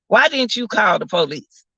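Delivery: usual speaking voice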